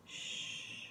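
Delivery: breathy hush for silence; a soft unvoiced shh; whisper. A narrator's reading. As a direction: hush them with no voice at all. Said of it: "The beast whispered".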